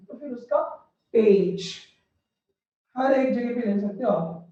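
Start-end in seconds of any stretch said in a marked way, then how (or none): 1.1-1.7 "पेज" with a long ay, pitched lower; long vowel demo. Shown as a demonstration. Teacher showing the vowel is not short, "पेज" with a long ay. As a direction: draw out the ए in "पेज". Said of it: "इतना short नहीं चाहिए".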